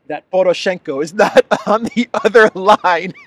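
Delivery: can't keep a straight face; laughing. Real, not acted. Laughs through the line.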